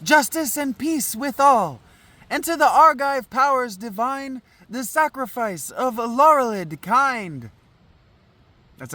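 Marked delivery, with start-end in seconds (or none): 0.0-7.5 higher-pitched ceremonial recitation; declaimed verse. Acted. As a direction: intone it as a choral prayer.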